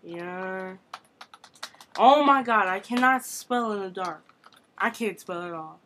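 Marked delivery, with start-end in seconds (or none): none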